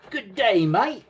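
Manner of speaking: high, hammed-up Aussie "Good day, mate"; mock Australian accent. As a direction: greet like an outback Australian. Aussie greeting, Crocodile Dundee bit.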